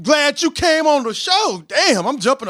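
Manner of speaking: high excited shout; can't contain the excitement; real excitement. Real, not acted. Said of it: "let me calm down".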